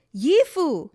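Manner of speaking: loud, high angry call; furious bellow. A narrator's reading. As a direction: bellow it, seething with anger. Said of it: "he bellowed".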